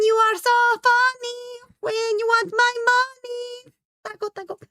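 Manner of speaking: a shrieking high pop-star parody. Acted. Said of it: "Do a [name] impression".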